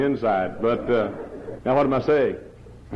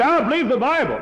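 high, louder mocking of an excuse; 11 semitones higher, 3 dB louder than usual. Preacher mimics a churchgoer's excuse, high and mocking. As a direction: play the self-satisfied churchgoer.

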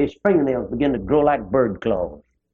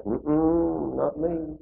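soft, shrinking mumble of refusal; quiet refusal; 6 dB quieter, much slower than usual. Retold or imitated. Poor little sinner pulling back from the king, soft and shrinking. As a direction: pull back and mutter it away. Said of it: "you poor little old thing pull back".

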